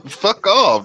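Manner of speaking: sharp high brush-off, mock annoyed; retold brush-off. Telling a curious Japanese guy to back off, high mock annoyance. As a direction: snap it off like shoving a guy away. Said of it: "I'm like".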